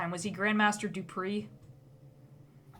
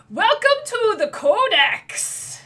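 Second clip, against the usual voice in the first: put-on high, slow, grand little boy welcome; child greeter; 12 semitones higher, 9 dB louder, slower than usual. Boy greeting the party in grand tones. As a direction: announce it like a proud little wizard.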